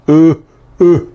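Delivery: "U" tight from the throat, not nasal; throaty "U" for contrast; plain guttural "U" set against the nasal one; guttural vowel. Shown as a demonstration. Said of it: "recuerda que el otro es gutural".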